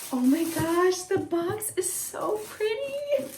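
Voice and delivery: high, soft, drawn-out squeal; hushed high-pitched gushing; awed delight. Real, not acted. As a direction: coo over something pretty. Awed by the pretty box.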